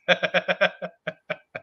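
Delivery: high, sustained cackle; delighted laugh. Real, not acted. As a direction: keep laughing in real delight. Delighted by the Dana lock.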